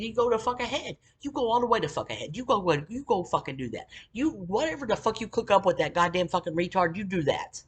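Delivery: indignant retelling of a quarrel; angry retort. Retold or imitated.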